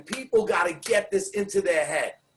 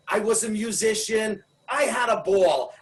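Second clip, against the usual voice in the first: ordinary delivery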